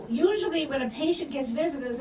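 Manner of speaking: normal talking voice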